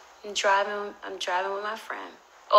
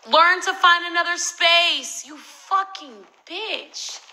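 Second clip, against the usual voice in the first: high, loud comeback, dragged out at the end; outraged shout, easing in volume at the end; angry yelling retort; 10 semitones higher, 9 dB louder, slower than usual. Acted. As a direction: yell back at the absent note writer. Yelling back at the note writer.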